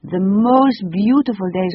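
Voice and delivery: put-on a touch of French accent, fond; French lady, wistful. A narrator's reading. The lady remembers England, light French accent. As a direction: reminisce fondly in a French accent.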